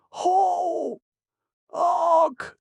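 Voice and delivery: shrieked high, loud bird honk; jay screech honk. Acted. Jay bird screeching its alarm, high loud honk. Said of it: "the jay screeched".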